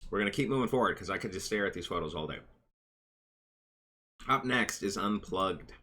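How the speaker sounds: usual speaking voice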